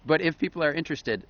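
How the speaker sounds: ordinary delivery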